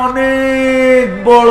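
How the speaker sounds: অনেক বড় stretched very long and loud; অনেক বড় said in a long, loud, stretched call; drawn out. Shown as a demonstration. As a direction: pull অনেক বড় out slowly to show its size. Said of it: "একটু লম্বা করে বলেন না".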